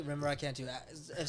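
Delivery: ordinary talk, nothing marked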